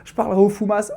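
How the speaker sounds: ordinary talk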